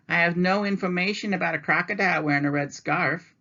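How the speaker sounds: information clerk. Acted. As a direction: recite it like a desk clerk. Information lady answers.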